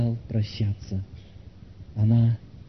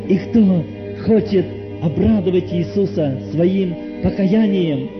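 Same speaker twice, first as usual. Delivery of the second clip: fervent pleading that surges higher on key appeals; impassioned altar call; 6 semitones higher, 5 dB louder than usual. Real, not acted. Preacher invites dear soul to repent now, fervent appeal. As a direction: plead with them to come, swelling and easing.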